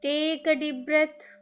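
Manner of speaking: very slow, drawn-out breathing instructions; guided meditation. Acted. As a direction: stretch each cue out slowly with long pauses.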